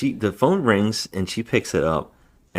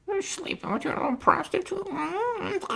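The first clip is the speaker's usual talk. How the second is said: high, squawky imitation of a duck; 4 dB quieter than usual. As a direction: play the caller as a quacking duck. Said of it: "somebody who talked like a duck".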